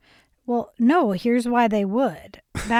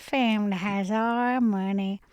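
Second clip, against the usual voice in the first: young Texan impression; 3 semitones higher than usual. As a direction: play an earnest Texas student, stretching the vowels. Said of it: "That's a great impression".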